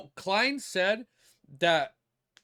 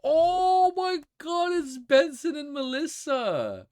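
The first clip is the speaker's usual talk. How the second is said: overwhelmed delight; 11 semitones higher, 4 dB louder, slower than usual. Real, not acted. Overwhelmed recognizing characters in art.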